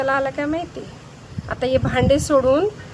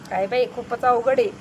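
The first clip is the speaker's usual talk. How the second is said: normal talking voice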